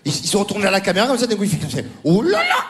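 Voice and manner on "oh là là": mock panic. Comedian mocking the player's panic, high and hammy. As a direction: panic over the chocolate bar, overplayed.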